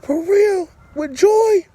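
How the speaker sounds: put-on high, amazed kid's voice; excited kids. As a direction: sons hearing who the mother is, high and amazed. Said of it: "It was like".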